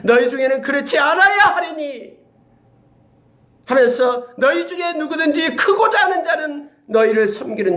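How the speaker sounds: high, solemn voice of Jesus teaching; high, loud solemn preacher's rendering of Christ; voicing Jesus. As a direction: raise the voice and proclaim as Jesus. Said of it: "이렇게 말합니다".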